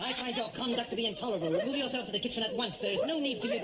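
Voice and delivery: haughty upper-crust scolding, high and loud. Acted. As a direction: order them out with lofty disdain. Mock stuffy butler dismissing someone.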